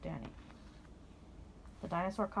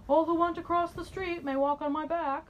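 higher, louder big friendly beast; booming dinosaur voice; 9 semitones higher, 4 dB louder than usual. Acted. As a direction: announce it like a kindly giant. Dinosaur addresses the street.

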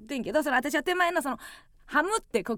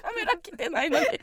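after laughing hard; 9 semitones higher, 4 dB louder than usual. Real, not acted. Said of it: "笑い転げてさ".

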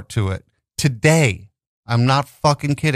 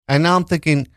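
ordinary delivery

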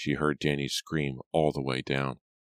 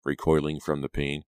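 ordinary delivery, nothing marked

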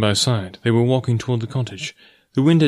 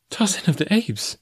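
high, softly voiced incredulous question; astonished cry; 8 semitones higher, 4 dB quieter than usual. A narrator's reading. Girl learns the forest man's name, crying out softly in astonishment. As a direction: echo the name in high-pitched surprise. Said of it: "she cried".

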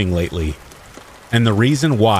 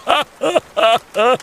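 put-on high cackling old man laugh; crazy old man laugh. A narrator's reading. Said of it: "He laughed".

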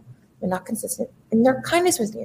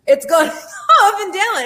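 laughing through the words, pitched up; 10 semitones higher, 4 dB louder than usual. Real, not acted. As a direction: break into a laugh mid-line. Laughs at her own stumbling.

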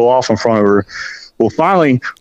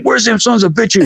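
higher-pitched mock angry movie holler; Seabass; 7 semitones higher than usual. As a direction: imitate an angry movie tough storming out. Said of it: "like that scene in Dumb and Dumber where Seabass comes running out".

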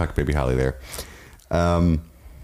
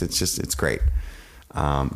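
ordinary delivery

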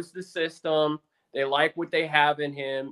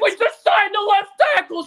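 much higher, louder thrilled blurt; excited announcement; 14 semitones higher, 7 dB louder than usual. Retold or imitated. Fan telling his wife about the signing, thrilled and high. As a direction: blurt the news, pitched way up. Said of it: "I was like".

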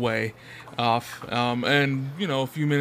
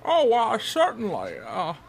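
put-on frail old man; 9 semitones higher than usual. GM voices the old Nurgling.

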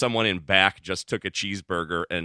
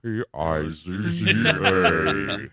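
slow spelled out; 4 semitones lower, much slower than usual. Pretend. Spelling it out slowly.

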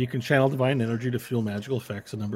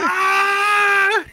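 much higher, loud frustrated squeal; halfling yell; 10 dB louder than usual. Acted. Halfling yelling in consternation, high squeal. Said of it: "my halfling voice".

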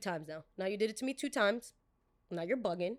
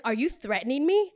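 loud indignant question; 4 semitones higher, 7 dB louder than usual. Retold or imitated. Customer alarmed.

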